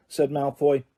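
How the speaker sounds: ordinary delivery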